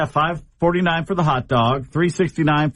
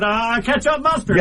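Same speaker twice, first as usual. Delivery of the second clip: panicked order; 8 semitones higher than usual. Retold or imitated. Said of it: "So I panicked".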